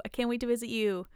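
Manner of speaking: usual speaking voice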